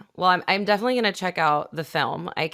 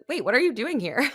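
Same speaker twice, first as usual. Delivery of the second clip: higher-pitched, lightly acted startled question; retold disbelief, pitched up; mock surprise; 7 semitones higher than usual. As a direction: replay a startled reaction to an unexpected visitor. Retelling startled reaction to spirit's visit.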